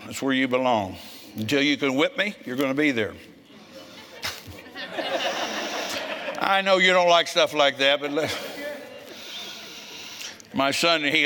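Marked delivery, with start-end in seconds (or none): 4.7-6.3 high breathy laugh. Real, not acted. Laughs after "until you can whip me.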